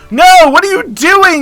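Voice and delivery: high, loud protest; exasperated. Real, not acted. Player scolding a wayward chicken, exasperated. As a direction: cry out in dismay.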